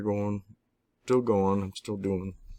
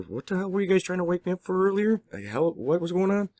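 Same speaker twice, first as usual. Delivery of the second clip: puzzled; 12 semitones higher than usual. Retold or imitated. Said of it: "I'm starting to remember".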